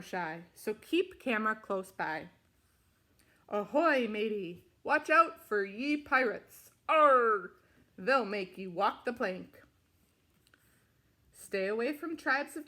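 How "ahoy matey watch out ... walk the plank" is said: hearty pirate growl, loud on ahoy. Acted. Reader warning kids about pirates, in a hearty pirate voice. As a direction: play it like a salty old pirate.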